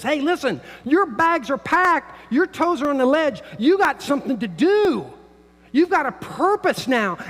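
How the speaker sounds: high and loud, pressing it home; Paul urging believers, preached loud and high; urgent preaching. Acted. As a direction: urge the congregation forward. Preacher voicing Paul's urging.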